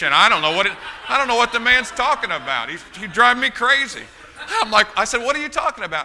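half-laughing bewilderment at the memory; laughing disbelief. Retold or imitated. Preacher recalling being baffled by his uncle, laughing loudly. Flustered by uncle's strange advice.

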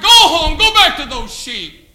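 loud laughing jeer, slowing down; mocking laugh. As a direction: laugh him off, loud and slow. Brother mocking David.

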